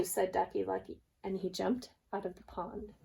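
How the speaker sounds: ordinary delivery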